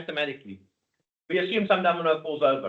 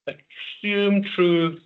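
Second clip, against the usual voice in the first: slow dictation; 3 semitones higher, much slower than usual. Shown as a demonstration. Teacher dictating slowly.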